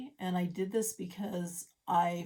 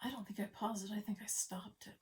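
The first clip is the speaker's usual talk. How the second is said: hushed whisper to herself; a soft whispered aside; 8 dB quieter than usual. Real, not acted. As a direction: whisper it under your breath.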